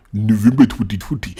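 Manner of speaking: put-on loud, high, rushed mangled English accent; exaggerated fake English accent, nearly shouted; mocking bad English accent. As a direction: parody a terrible English accent, loudly. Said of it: "What did he just say?".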